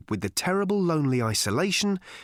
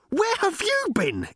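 very high, drawn-out demand; astonished interruption; slower than usual. A narrator's reading. Arthur interrupts his long-lost friend, astonished and high. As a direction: burst in with incredulous high pitch. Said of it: "interrupted Arthur".

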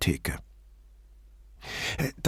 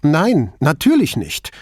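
higher, louder, indignant retort; angry denial; 5 semitones higher, 5 dB louder than usual. A narrator's reading. Shop owner turning her anger on Brunetti, indignant. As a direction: throw the question back angrily. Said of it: "Ihr Zorn schien sich nun gegen ihn zu richten".

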